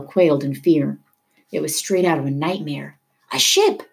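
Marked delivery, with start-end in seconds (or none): 3.3-3.8 high-pitched alarmed yell. A narrator's reading. Leto alerts the boat to the tanker, yelling. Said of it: "Leto yelled".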